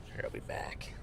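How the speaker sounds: soft whisper off the mic. Real, not acted. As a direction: breathe it, no voice. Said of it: "whispered aside off mic".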